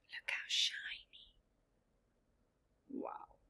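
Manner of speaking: soft whispered wonder; hushed aside. Real, not acted. Teacher showing children the picture, whispered awe. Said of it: "whispered aside to children".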